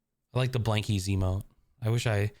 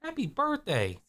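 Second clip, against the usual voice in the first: high, sing-song birthday wish; cutesy greeting; 13 semitones higher than usual. Real, not acted. Streamer greets Ava on her birthday, high and cooing.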